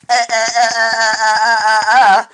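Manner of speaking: high, stuttering uh uh uh; stammering panic noises. Retold or imitated. Mock panic sounds, stuttered uh uh uh. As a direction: sputter like you're panicking. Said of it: "I'm like".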